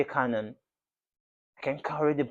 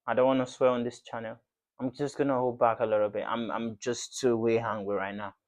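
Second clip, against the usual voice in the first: ordinary talk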